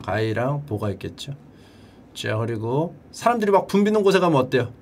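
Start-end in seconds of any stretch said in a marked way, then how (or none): none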